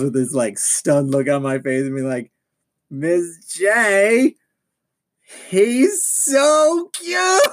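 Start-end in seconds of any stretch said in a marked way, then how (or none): 2.9-7.4 high squealing swoon. Retold or imitated. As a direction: gush like a starstruck fan. Retelling swooning at Jason Todd.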